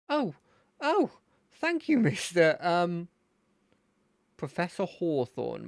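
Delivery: put-on nervous kid thanking his hero; [name]'s voice.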